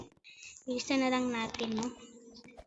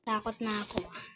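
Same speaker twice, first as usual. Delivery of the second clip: normal talking voice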